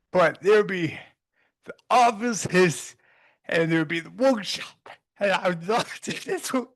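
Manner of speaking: choked up with a laugh escaping; laughing and crying. Real, not acted. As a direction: push on through tears, halting. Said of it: "I don't know why I'm laughing and crying".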